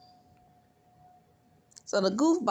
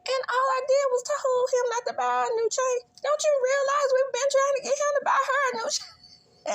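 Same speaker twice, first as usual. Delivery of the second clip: very high, louder, hammed-up whine; high whiny excuse; 4 dB louder than usual. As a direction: whine it like a caught guilty man. The man's flustered excuse.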